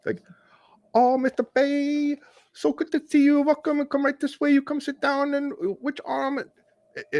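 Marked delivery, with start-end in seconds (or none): 0.9-6.4 hammed-up Vietnamese accent, way up high; mock Vietnamese accent. Patient imitating the Vietnamese nurse's welcome in accent. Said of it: "I'm totally doing the, the accent, like, horrible".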